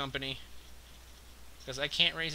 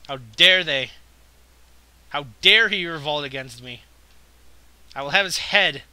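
much louder, indignant outrage; hammed-up kingly wrath; mock-royal fury; 10 dB louder than usual. Real, not acted. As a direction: rage like an offended king. Furious ruler at revolt.

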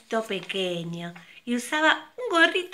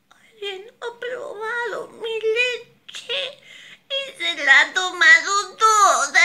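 much higher crying little bear voice, slow then loud; baby bear crying; 12 semitones higher, 8 dB louder, slower than usual. Acted. Baby bear cries that his milk is gone, high sobbing wail. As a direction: wail it like a small bear cub. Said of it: "dijo llorando y con voz suave".

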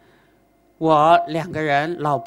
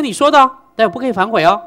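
teasing warning; 5 semitones higher, 9 dB louder, faster than usual. Retold or imitated. Master binds the father to promise.

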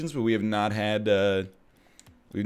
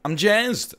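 high burst of excitement, louder; excited; 10 semitones higher, 3 dB louder than usual. Real, not acted. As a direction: pop the word up, thrilled. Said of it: "here's how you can tell I'm excited".

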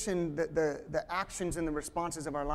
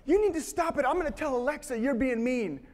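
put-on much higher, a child scolding her sister; little girl voice; 7 semitones higher than usual. Father mimics his four-year-old scolding her sister, much higher child voice. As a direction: play the bossy four-year-old threatening to tell.

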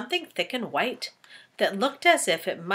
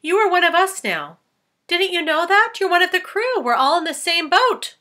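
high, louder, kind insect voice; reassuring ladybug; 10 semitones higher, 6 dB louder than usual. Acted. Ladybug welcoming James to the crew, high and kind. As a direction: comfort him in a high friendly ladybug voice.

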